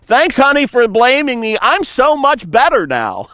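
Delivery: high, loud mock-grateful sarcasm; sarcastic thank-you to a spouse. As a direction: gush fake gratitude, dripping irony.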